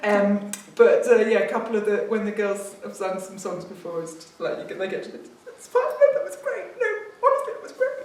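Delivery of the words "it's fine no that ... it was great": very high, choked-up imitation of weeping praise; mock tearful reassurance. Panel member in tears reassuring girls who sang, mock tearful. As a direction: squeak out reassurance as if welling up. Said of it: "And they're weeping. Good weeping".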